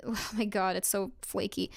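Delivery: ordinary talk, nothing marked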